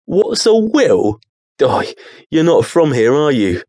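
can't believe he doesn't know; incredulous creature. Acted. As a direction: creature realizing the boy is a stranger.

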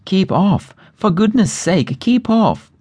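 high, urgent cry to keep away; panicked sisters calling out high. A narrator's reading. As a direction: shout a warning, pitched high. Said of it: "the others cried out".

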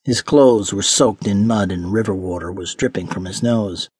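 ordinary delivery

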